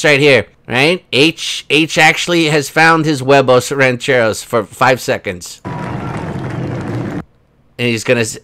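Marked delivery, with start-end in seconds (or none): none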